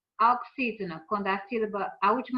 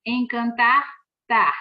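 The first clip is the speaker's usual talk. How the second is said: "Encantar" loud on final "tar", then "tar" alone; stress on TAR; 7 dB louder, slower than usual. Shown as a demonstration. Teacher showing the strongest syllable, loud "tar" in "Encantar". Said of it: "a sílaba mais forte".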